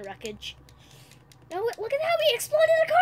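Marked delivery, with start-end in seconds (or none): 1.5-3.0 high, loud excited yelling. Real, not acted. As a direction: squeal at the explosion. Sees the car explode.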